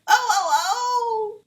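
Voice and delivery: long, high, loud wailing cries; screaming witch. A narrator's reading. Melting witch screams in anguish, wailing. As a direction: wail as you start to melt. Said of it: "screamed the witch".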